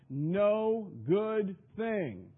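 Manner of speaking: No good thing said very slowly, hitting No; solemn emphatic echo; slow stressed repeat. Shown as a demonstration. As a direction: drag out each word for weight. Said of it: "dwelleth no good thing".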